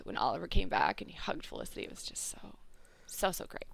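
whispered, awed; soft unvoiced gushing; breathless whisper. Real, not acted. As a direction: hush it as if overwhelmed. Gushing over a hug.

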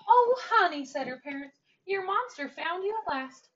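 bright, high and loud joyful greeting; delighted parents. A narrator's reading. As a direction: parents overjoyed she is home. Parents greet returning daughter.

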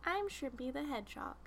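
small, soft, shy little creature voice; hedgehog voice. Pretend. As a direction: do a timid hedgehog on request. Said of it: "Try your hedgehog voice".

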